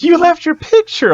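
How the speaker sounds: very high, loud imitation of a lady; mock calling out. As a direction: call after him in a put-on high voice. Lady calls out, retold.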